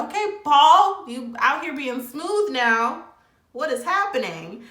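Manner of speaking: playfully shocked, high and loud; teasing disbelief. Retold or imitated. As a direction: draw it out like you caught him flirting. Teasing Paul for his smooth talk.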